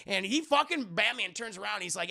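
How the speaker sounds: ordinary talk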